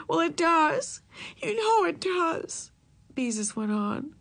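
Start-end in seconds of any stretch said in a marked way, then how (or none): 0.1-2.7 upset. A narrator's reading. Upset girl insists.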